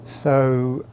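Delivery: usual speaking voice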